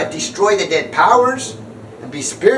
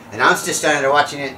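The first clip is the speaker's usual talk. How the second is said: usual speaking voice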